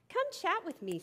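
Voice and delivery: high, chirpy grasshopper voice; carefree chirpy grasshopper. Acted. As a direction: sing it out lightly, carefree.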